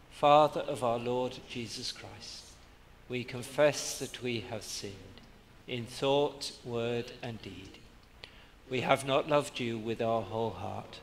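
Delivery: ordinary delivery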